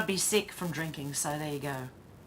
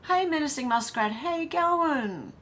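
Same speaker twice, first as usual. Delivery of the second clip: singsong greeting; 9 semitones higher, 4 dB louder than usual. Real, not acted. Greeting chat viewers singsong.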